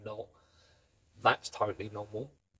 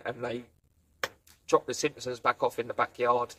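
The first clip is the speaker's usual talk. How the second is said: usual speaking voice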